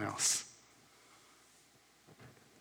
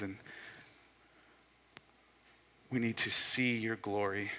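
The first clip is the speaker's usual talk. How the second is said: prayer. Real, not acted. Pastor praying for the congregation before the table, prayer. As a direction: address God humbly, letting phrases settle.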